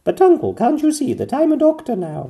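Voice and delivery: high, loud protesting princess; Pearl protesting. A narrator's reading. Pearl protesting to her uncle, pleading. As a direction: plead your case to your uncle. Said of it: "said Pearl".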